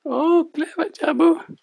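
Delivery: squeaky, high cartoon puppet sidekick. Puppet co-host Lani speaks.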